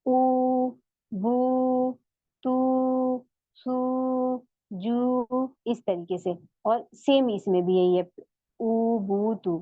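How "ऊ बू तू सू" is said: ऊ, बू, तू, सू held long with round lips; slow rounded-lip recitation; rounded lips, drawn. Shown as a demonstration. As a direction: lips rounded, stretch each ऊ. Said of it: "होंठ गोल रहेंगे, जब हम वाव मद कहेंगे".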